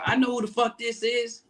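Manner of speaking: high-pitched mock indignation; high, impatient imitation; retold indignant retort. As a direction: imitate an annoyed boss cutting the caller off, voice up. Retells hostile phone answer.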